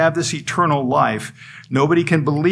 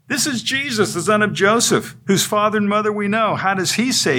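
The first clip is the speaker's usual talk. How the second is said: scornful, much higher voice of the doubters; scoffing crowd; 7 semitones higher than usual. Acted. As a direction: sneer it like a skeptical crowd. Crowd scoffs at Jesus' claim.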